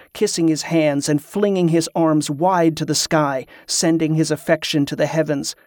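usual speaking voice

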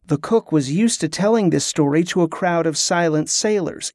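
plain reading voice

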